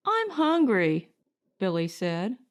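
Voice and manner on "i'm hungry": put-on a boy's whine, pitched higher; small son complaining about dinner; young boy. Young son Billy tells his father he's hungry, high childish whine.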